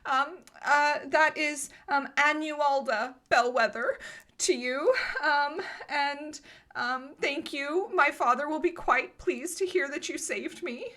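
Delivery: put-on high-pitched, grateful young lady; prim rescued girl. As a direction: thank your rescuers in a high, proper voice. Game master voices rescued girl.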